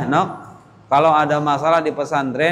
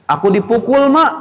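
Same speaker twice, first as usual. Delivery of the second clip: higher, louder tattling whine; complaining child; 7 semitones higher, 5 dB louder than usual. Retold or imitated. Santri telling mother he was hit, high loud whine. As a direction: cry foul like a hurt kid.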